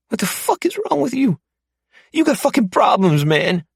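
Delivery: high, loud incredulous outburst; captive man turning on his captor. Acted. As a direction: hurl it back at him, high and loud. Mark lashes out.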